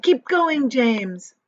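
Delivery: loud, high encouragement; cried out. Acted. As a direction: urge James on. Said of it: "they cried".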